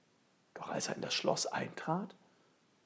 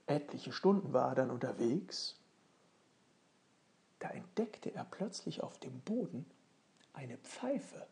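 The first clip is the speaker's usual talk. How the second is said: plain reading voice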